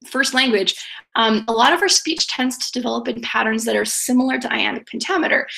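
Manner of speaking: ordinary delivery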